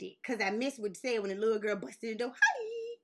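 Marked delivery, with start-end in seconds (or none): 2.4-2.9 perky mock greeting. Repeats girlfriend's greeting.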